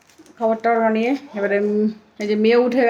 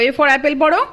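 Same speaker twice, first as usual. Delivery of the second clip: higher, louder, prompting পড়ো with a rise; 5 semitones higher, 7 dB louder than usual. Real, not acted. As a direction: coax her to read পড়ো. Reading to a toddler.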